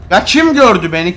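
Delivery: usual speaking voice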